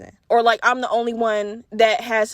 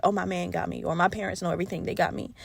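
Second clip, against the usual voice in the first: soft, hushed mock airhead voice; softer mock of a carefree girlfriend; dreamy trusting girl; 5 semitones lower, 8 dB quieter than usual. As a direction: coo it like a girl with her brain switched off. Mimicking girls who trust their man.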